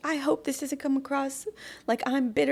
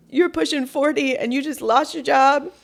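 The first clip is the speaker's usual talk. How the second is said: usual speaking voice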